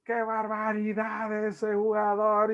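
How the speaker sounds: high, slow hammed-up yell at a player; mock stadium shout. A girl in the stadium yelling at a player, mock shout. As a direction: play an angry fan booing the field. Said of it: "empieza a gritar".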